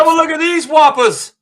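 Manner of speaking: put-on high, chirpy British toddler voice; kid's British accent. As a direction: squeak it out like a tiny British boy. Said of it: "in a British accent".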